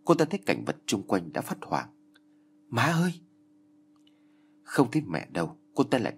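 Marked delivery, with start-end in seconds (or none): 2.7-3.1 high, panicked call for mom; frightened cry. Acted. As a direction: cry out in sudden fear. Daughter wakes terrified, calls mother. (tiếng gọi hoảng hốt)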